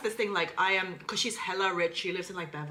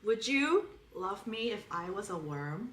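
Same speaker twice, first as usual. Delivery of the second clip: slow, earnest put-on American; sincere American accent; 4 dB quieter, slower than usual. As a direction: ask it tenderly in an American accent. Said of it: "say in a sincere American accent to David".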